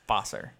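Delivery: "Vosser" said with an F, as Fosser; confident pronunciation. Shown as a demonstration. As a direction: state "Vosser" with an initial F. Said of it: "an F".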